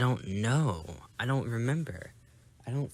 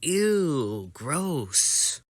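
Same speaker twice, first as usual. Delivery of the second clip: put-on drawn-out, loud, grossed-out kid; exaggerated yuck; childish disgust; 4 semitones higher, 4 dB louder than usual. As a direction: recoil with a big swooping "ew". Child disgusted after being kissed.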